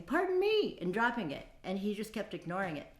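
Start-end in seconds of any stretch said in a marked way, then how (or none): 0.0-0.8 high, loud coy "Pardon me"; flirt, act like it slipped. Retold or imitated.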